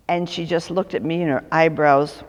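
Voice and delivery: normal talking voice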